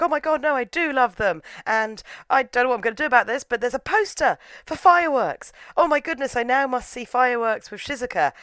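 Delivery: high, excited melodrama; mock breathless realization. Sakura realizes he loves Shizuka, hammed-up excitement. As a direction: gush it like a sudden revelation. Acted sudden realization of love.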